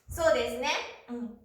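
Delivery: ordinary talk, nothing marked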